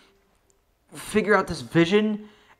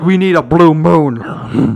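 mocking drawl; 8 dB louder, slower than usual. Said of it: "They're just like".

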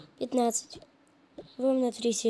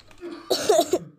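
loud patient's coughing fit; sick cough; 7 semitones higher, 7 dB louder than usual. Pretend. Patient at the doctor's exam, coughing loudly.

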